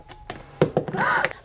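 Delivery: sharp unvoiced gasp; surprised gasp. Real, not acted. Surprised by protein bar label.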